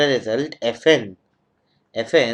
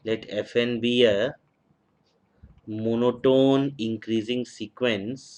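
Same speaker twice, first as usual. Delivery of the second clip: slow dictation; much slower than usual. Shown as a demonstration. Teacher dictating theorem for notes.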